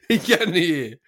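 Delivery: said through a laugh; laughing correction. Real, not acted. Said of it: "not deaf".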